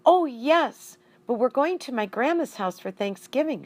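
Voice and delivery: put-on eager kid answering teacher; lightly voiced young girl; child's voice.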